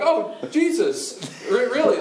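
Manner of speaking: high, loud, drawn-out mock shock; comic astonishment. Disciples suddenly recognizing Jesus, comic loud astonishment. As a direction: react in hammed-up disbelief.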